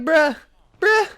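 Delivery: loud, high, abrupt disbelief; mock outrage. Student ratted out to the feds, mock outrage. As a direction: throw out each "bruh" as a high protest.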